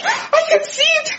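very high, breathy fright; frightened. Acted. Boss spotting the snake, very high fright. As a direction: voice jumping up in fear.

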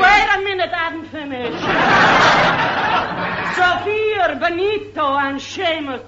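put-on comic Italian accent reeling off names; stage Italian, rattling through the children; hammed-up Italian mamma listing bambinos; Italian wife. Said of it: "I played Missus Guglielmo Marconi".

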